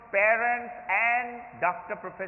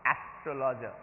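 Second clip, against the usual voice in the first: ordinary talk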